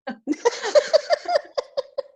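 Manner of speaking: sudden high laugh at herself; high burst of laughter. Real, not acted. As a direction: break into a squeaky laugh.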